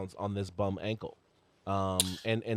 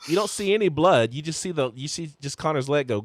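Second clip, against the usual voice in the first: ordinary talk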